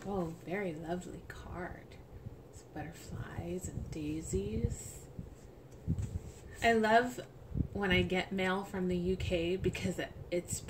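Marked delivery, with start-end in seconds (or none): 0.1-5.0 soft whispered admiration. Real, not acted. Admiring the greeting card, whispered.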